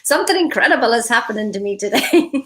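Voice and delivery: giggling as she passes on the line; laughing quote. Retold or imitated. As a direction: repeat it while cracking up. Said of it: "Wolfgang's actually saying".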